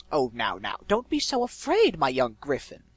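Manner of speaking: loud, swooping villainous coaxing; eloquent malevolent voice. A narrator's reading. Unseen male voice soothing frightened griffin, mock-kindly villain. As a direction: patronize grandly, pitch leaping up. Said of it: "it was male and rather eloquent".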